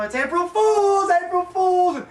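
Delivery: high, drawn-out playful cry; prank reveal. Retold or imitated. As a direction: sing out the joke to win her back. Revealing the prank to crying girlfriend.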